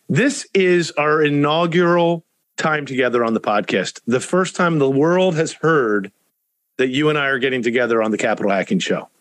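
usual speaking voice

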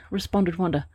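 ordinary delivery, nothing marked

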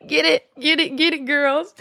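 put-on high, excited cheer for the girls. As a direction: hype them up like a fan watching TV. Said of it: "I was like".

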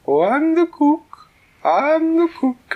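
very high, loud and slow silly goose. Acted. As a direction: coo it like a giddy goose. Goose cook introduces herself.

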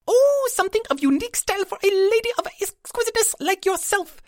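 very high, perky little fairy voice; a tiny proprietor, high and chirpy; pixie shopkeeper. A narrator's reading. Pixie flatters customer.

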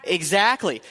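normal talking voice

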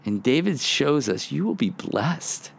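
usual speaking voice

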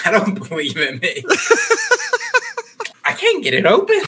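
high helpless laughing. Real, not acted. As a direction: squeak it out mid-laugh.

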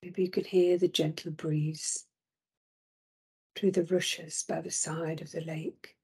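usual speaking voice

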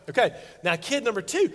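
ordinary talk

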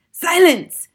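high, very loud command; shouted. A narrator's reading. Grand High Witch silencing the witches, loud shout. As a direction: bellow it as an imperious witch. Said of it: "shouted the Grand High Witch".

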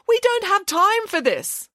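high, mock wail of a panicked teacher. A panicked teacher wails about the early flight, high. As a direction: whine it like a frantic chaperone.

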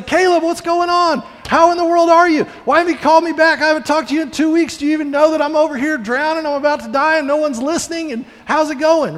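high, loud, fast rant at a friend; mock frantic yelling. Calling friend Caleb who never called back, frantic yelling. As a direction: yell it like you've been ignored for weeks. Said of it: "We, we yell".

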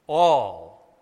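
"All." on its own, loud and low; emphatic single word. Shown as a demonstration. Preacher driving home the key word to the congregation, a loud low "All.". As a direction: land "All." like a hammer blow. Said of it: "That's an important word".

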